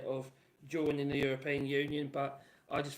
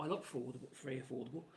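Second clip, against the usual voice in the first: ordinary delivery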